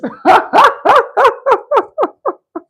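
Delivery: loud, high cackling laugh; real laughter. Real, not acted. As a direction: crack up in a long, loud peal. Cracking up at Edge's voice.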